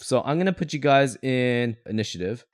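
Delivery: usual speaking voice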